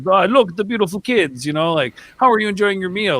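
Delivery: a hint of an old Italian owner greeting guests; Italian accent. Pizzeria owner greets a family's kids, light Italian accent. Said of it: "his little Italian accent".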